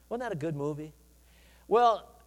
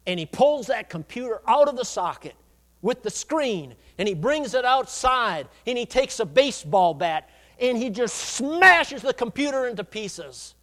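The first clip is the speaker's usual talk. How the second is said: raised, punchy storytelling; dramatic preaching narration; 4 semitones higher, 6 dB louder than usual. Acted. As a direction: build the action to a forceful stretched verb. Dramatic sermon story retelling.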